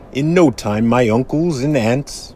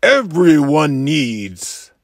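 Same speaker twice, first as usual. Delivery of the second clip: angry yelling; 4 semitones higher than usual. Acted. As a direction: shout him down, rising with each biggering. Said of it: "I yelled at the Lorax".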